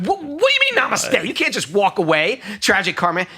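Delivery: high-pitched incredulous outburst; alarmed protest. Retold or imitated. Monk protesting a doom prediction to a palm reader, alarmed. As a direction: blurt it out in disbelief, voice jumping high.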